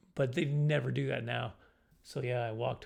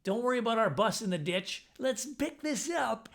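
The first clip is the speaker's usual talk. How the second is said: high, hammed-up daredevil driver voice; mock gung-ho driver; 9 semitones higher than usual. Narrator mocking a reckless driver, high and hammed-up. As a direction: cheer the bus on like a reckless driver.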